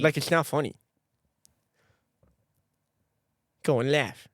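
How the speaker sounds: mock-earnest lisped protest; put-on lisp. Prankster telling friend it is an impediment, lisping. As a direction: lisp heavily while insisting it is real.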